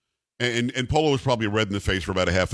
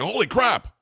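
stunned exclamation; 10 semitones higher than usual. Retold or imitated. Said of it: "was like".

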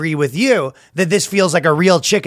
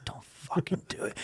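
whispered warning to oneself; mock tense whisper; 2 semitones higher, 18 dB quieter than usual. Imitating a man warning himself, intense whisper. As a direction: hiss it under the breath, voiceless.

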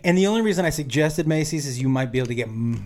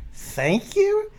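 high-pitched incredulous question; incredulous echo. Retold or imitated. Mocking his expected thanks, incredulous. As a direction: throw it back in high disbelief. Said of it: "I'm like".

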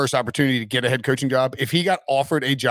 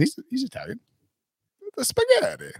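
put-on Mario accent; 12 semitones higher than usual. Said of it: "more like a Mario accent".

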